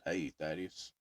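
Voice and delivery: lightly voiced deep country man. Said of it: "Had that [name] voice".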